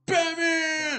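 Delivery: loud, high cheer; excited shout. Real, not acted. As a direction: shout the name with glee. Cheers at the video's title.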